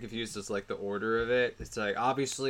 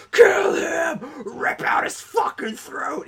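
much higher, louder shouted killer-doll voice; Chucky's screeching command; 6 dB louder, much slower than usual. Retold or imitated. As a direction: shriek orders like a possessed doll. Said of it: "Chucky's head is still talking".

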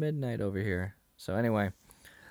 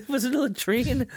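much higher, squeaky mock question; mock incredulous; 13 semitones higher, 5 dB louder than usual. Retelling the latrine joke, squeaky high mock question. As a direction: squeak it out in comic disbelief. Acted quote in retold scene.

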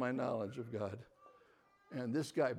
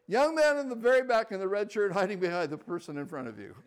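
loud, high call to the back row; 7 semitones higher, 9 dB louder than usual. Retold or imitated. Speaker singling out the hider, loud call. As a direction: call him out across the room. Speaker calls him out publicly.

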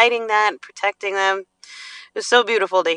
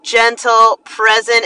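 ordinary delivery

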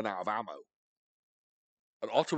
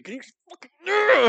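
high, loud burst of exertion; hammed-up struggle; 11 dB louder than usual. Showing how to fight off a flying eagle, strained shout. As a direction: shout it as if wrestling a bird.

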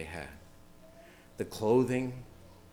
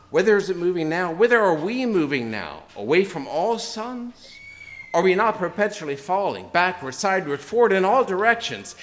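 higher, louder, urgent proclamation; impassioned madman; 6 semitones higher, 6 dB louder than usual. A narrator's reading. Preacher voicing Nietzsche's madman to his congregation, impassioned and loud. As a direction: preach the madman's despair with rising force. Madman's speech read in sermon.

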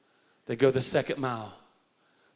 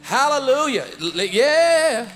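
loud, high preacher's exclamation; preaching; 10 semitones higher, 9 dB louder, slower than usual. Retold or imitated. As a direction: shout praise like in the pulpit. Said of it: "I was just preaching".

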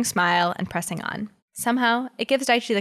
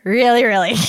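put-on drunk insistence; 3 semitones higher, 7 dB louder than usual. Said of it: "words slurred".

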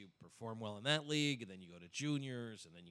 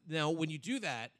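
ordinary delivery

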